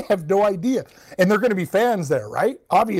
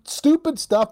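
exasperated; 9 semitones higher, slower than usual. Real, not acted. Mocking the goalie's blunders.

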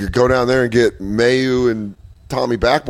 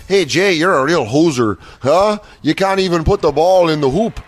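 higher-pitched mock-Canadian heckle; Canadian accent; 4 semitones higher than usual. Opponent dribbling and talking trash, Canadian accent. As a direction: taunt in a broad Canadian accent. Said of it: "so I'll just do a Canadian accent".

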